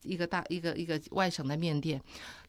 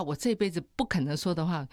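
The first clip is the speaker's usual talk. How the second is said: normal talking voice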